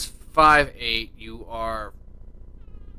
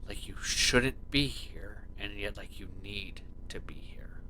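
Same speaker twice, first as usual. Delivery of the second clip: soft scene narration; 5 semitones lower, 14 dB quieter than usual. A narrator's reading. GM sets scene softly.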